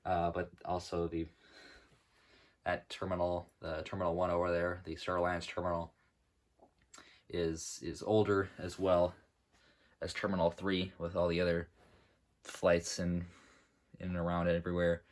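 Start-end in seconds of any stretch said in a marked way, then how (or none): none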